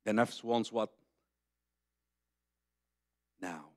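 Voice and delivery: ordinary talk, nothing marked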